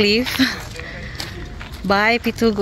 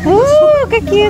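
excited; 13 semitones higher, 9 dB louder, slower than usual. Real, not acted. Fish nibbling feet.

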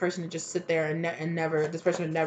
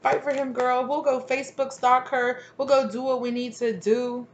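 higher, egging-on cheer; hype friends; 6 semitones higher than usual. Retold or imitated. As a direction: hype her up like a pushy girlfriend. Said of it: "unwoke people gassing her up".

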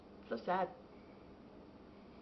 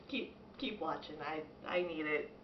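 ordinary delivery

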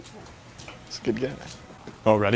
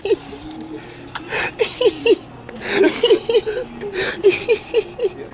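long, high squealing laugh; helpless laughing fit. Real, not acted. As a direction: laugh till it turns to squeals.

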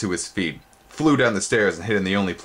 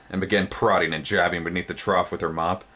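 plain reading voice

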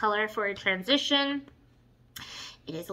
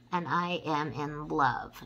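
ordinary delivery

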